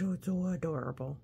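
plain reading voice

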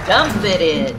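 ordinary delivery, nothing marked

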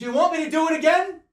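fast, high, loud challenge; shouting. Real, not acted. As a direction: shout it out defiantly.

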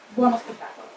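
normal talking voice

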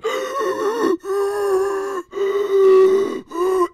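long high mock gasp; loud shocked gasp. Seeing the huge girl enter the play, a high gasp.

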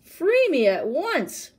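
high, loud, slow command; ordering. A narrator's reading. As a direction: demand it as a trapped tiger. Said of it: "ordered the tiger".

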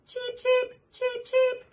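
high little chick peeps; high chirpy chick voice; baby chick cheeping. Acted. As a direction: peep it out like a tiny chick. Reading animal book to child.

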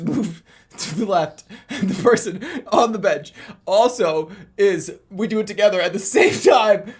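words breaking into laughter, rising loud; laughing so hard it bursts into a shout at the end; laughing while talking. Real, not acted. Vlogger cracking up at his own gym bit, laughing.

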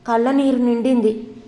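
ordinary delivery

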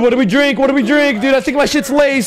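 high, loud, panicked rant; frantic, high-pitched alarm; freaking out. Real, not acted. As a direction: blurt it in rising paranoia. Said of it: "I'm freaking out".

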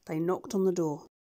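ordinary delivery

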